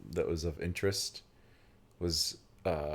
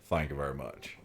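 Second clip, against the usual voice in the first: lower, softer Texan "Thank you very much."; Texas accent; 5 semitones lower, 5 dB quieter than usual. Pretend. Imagining thanking the vendor, lower Texas accent. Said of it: "I could have gone deep ... my Texas roots".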